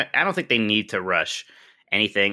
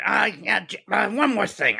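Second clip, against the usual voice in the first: Columbo impression; 6 semitones higher than usual. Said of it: "I probably sound more like Roz".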